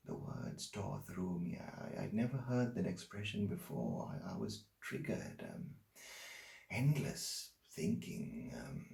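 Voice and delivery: very soft, halting, fading almost to breath; haunted hush. Acted. Man relives sleepless nights after her words hit him, haunted hush. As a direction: sink into the memory, barely voiced.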